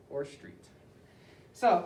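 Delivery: usual speaking voice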